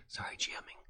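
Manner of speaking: whispered "G M ing" as a joking self-correction; sheepish whisper. Shown as a demonstration. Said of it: "he's a trademark".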